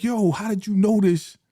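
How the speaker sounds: hammed-up angry friend; imagined Tiff berating Keisha, outraged; mock outrage. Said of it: "be like".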